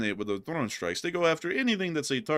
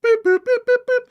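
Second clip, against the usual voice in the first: high, loud electronic beeps; loud monotone boops; imitated dial tones; 10 dB louder than usual. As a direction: mimic phone tones on one high note. Imitating a failed call's tones.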